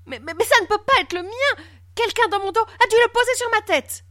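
very high, panicky protest; stammer, then plead innocence at a high pitch; flustered stammering. A narrator's reading. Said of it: "bredouilla-t-il". (bredouillant, paniqué, voix très aiguë)

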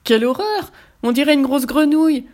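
a wolf shocked by his own green reflection, crying out; wolf exclaiming. A narrator's reading. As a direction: recoil in horror at the mirror.

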